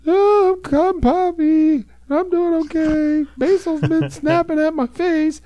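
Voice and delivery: put-on very high, bright squeaky girl dog; squeaky Poppy voice. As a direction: pitch way up like a wired little dog after coffee. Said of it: "once she's had her coffee, she's like".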